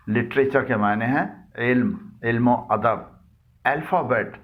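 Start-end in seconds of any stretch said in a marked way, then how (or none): none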